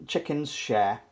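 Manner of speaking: plain reading voice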